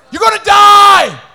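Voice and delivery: a loud threatening yell; menacing shout. Acted. Preacher voicing the devil's threat with a shout. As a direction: roar it out as the enemy.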